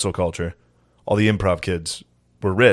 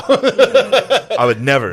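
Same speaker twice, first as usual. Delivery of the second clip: high, loud, very slow "I would never"; mock innocent; 9 semitones higher, 5 dB louder, much slower than usual. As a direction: drag it out in fake innocence. Repeats denial, drawn out.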